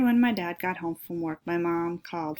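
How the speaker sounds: plain reading voice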